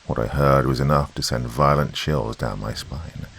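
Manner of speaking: ordinary delivery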